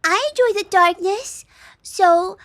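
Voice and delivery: put-on high, loud cutesy slime girl; cringe cute voice. Slime girl enjoying darkness, high cringey cute voice. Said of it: "do I do the ooh voice?".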